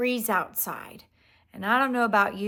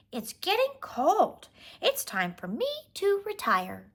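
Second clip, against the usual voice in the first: chipmunk voice; 9 semitones higher than usual. Acted. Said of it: "she said".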